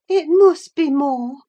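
fretful invalid recalling, pitched well up; doubting Catherine. A narrator's reading. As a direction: insist from memory in a high voice. Narrator voices Catherine recalling quarrel.

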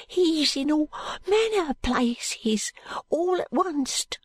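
slow, high, awestruck urchin. A narrator's reading. Jo warning Allan of his pursuer, hushed high voice. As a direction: warn in a small high hushed voice.

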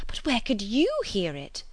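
high, baffled questioning; a mild cry of disbelief; astonished cry. A narrator's reading. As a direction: demand to know, amazed. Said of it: "cried Miss Bates".

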